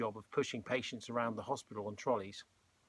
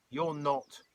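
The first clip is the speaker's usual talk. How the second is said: short, firm put-down, slightly raised; curt; 3 semitones higher, 4 dB louder than usual. A narrator's reading. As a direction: cut her off curtly. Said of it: "replied George curtly".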